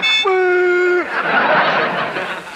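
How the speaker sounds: high wheezy laugh; wheezing cackle. Acted. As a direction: cackle triumphantly. Professor laughs.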